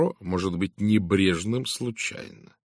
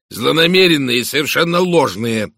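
loud, higher, indignant blurt; indignant outburst; 5 semitones higher, 9 dB louder than usual. A narrator's reading. Accused husband interrupts about rumours, blurting indignantly. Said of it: "возмущённо выпалил Альфред Инглторп".

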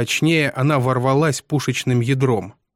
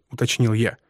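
ordinary delivery, nothing marked

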